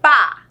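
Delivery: PÁ as one loud, open syllable; demonstrated syllable. Shown as a demonstration. Teacher showing pupils one mouth opening, clearly sounded PÁ. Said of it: "Vamos observar?".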